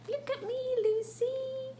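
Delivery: very high little bird voice. Acted. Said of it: "And the bird says".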